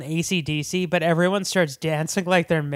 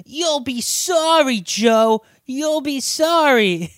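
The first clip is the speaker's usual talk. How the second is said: high, drawn-out taunting warning; mock threat; 8 semitones higher, 3 dB louder, slower than usual. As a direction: stretch it out high, imitating a taunter. Mimicking AJ popping up with a threat.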